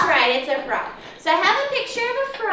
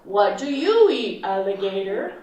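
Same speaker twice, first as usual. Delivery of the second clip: wide-mouthed frog voice; 8 semitones lower than usual. Acted. Teacher voicing the frog for the class, low and booming. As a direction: boom it out like a proud frog. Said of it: "said the wide-mouthed frog".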